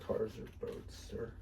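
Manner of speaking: muttered aside. Real, not acted. Trailing off muttering.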